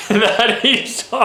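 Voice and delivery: laughing through the words. Real, not acted. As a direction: crack up mid-sentence at yourself. Cracks up at own accent attempt.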